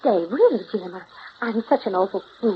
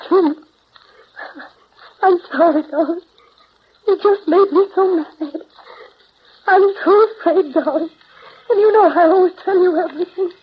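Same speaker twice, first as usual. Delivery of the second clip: high, loud, pleading apology; distraught apology; 7 semitones higher, 5 dB louder than usual. Acted. Woman apologizing to her darling after losing her temper, distraught pleading. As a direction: plead for forgiveness with the voice pitched up.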